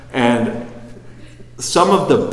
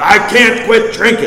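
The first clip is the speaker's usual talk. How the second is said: high, loud desperate cry; 10 semitones higher, 8 dB louder than usual. Retold or imitated. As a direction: plead loudly that you can't stop. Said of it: "He goes".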